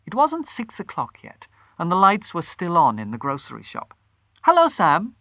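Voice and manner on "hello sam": high, bright, louder hello; bright greeting. A narrator's reading. Wife greeting the grocer, high and bright. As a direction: greet him with forced cheer. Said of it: "she said brightly".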